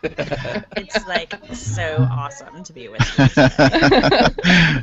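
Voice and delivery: put-on slow, loud Valley girl accent. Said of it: "You actually sound like a Valley girl".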